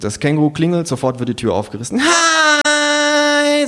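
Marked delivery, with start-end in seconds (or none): 2.0-3.7 very high, loud, long drawn-out "Hi" like a dentist's drill; drawn-out shrill hi. Acted. Trendy hostess greets guests at the door, piercing drawn-out hi. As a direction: stretch the I into a piercing whine. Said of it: "das langgezogene I hat verblüffende Ähnlichkeit mit dem Geräusch eines Zahnarztbohrers".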